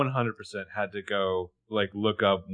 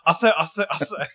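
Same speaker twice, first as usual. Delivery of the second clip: Foghorn Leghorn; 6 semitones higher than usual. Retold or imitated. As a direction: bluster like a cartoon Southern rooster. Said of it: "I could just do it as, like, Foghorn Leghorn".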